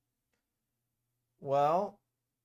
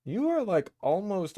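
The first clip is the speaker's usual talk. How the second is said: much higher, loud, gushing; 6 semitones higher, 5 dB louder than usual. Real, not acted. Cooing at pet lizard.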